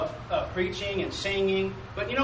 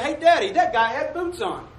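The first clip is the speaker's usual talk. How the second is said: put-on eager child; 6 semitones higher than usual. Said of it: "He said".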